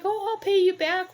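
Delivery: put-on eager old lady thanking; grateful old lady. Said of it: "she was like".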